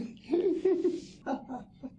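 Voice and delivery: a high burst of real laughter; amused high laugh. Real, not acted. Laughs after Cobb story.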